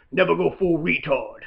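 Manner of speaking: a lightly voiced hardened army colonel; low, soft colonel growl, quoting the movie; Robert Downey impression. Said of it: "my impression of Robert Downey's impression".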